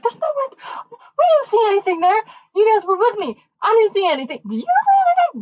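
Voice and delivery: high, fast, frantic denial; panicked squeal. Retold or imitated. Teen girl panicking to her friends about the photo.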